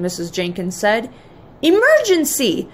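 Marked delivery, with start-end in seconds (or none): 1.6-2.7 high-pitched alarm call; raised, urgent cry; urgent shout. A narrator's reading. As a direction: sound the alarm as a storybook hero. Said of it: "Patrick shouted".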